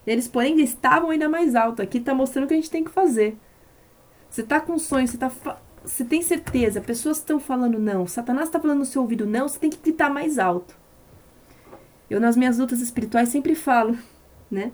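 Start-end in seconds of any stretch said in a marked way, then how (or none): 0.1-10.8 raised, high preaching voice; fervent preaching. Acted. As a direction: preach it out with rising fervour. Preaching at full force.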